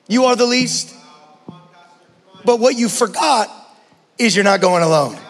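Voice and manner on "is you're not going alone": normal talking voice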